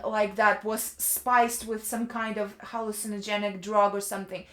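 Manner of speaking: normal talking voice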